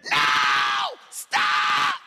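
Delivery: sudden loud yell of stop; shouted warning. Retold or imitated. Kids on the bus shout at the praying youth pastor, a loud yell. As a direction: yell at him to quit before it's too late. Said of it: "All of us veteran youth group kids said".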